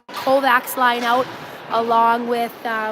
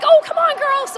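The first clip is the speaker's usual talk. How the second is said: yelling from the sidelines. Real, not acted. Urging on the team.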